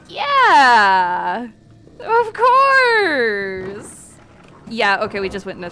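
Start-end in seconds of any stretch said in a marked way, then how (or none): 0.1-4.1 exaggerated assurance. Mock-dramatic answer to chat.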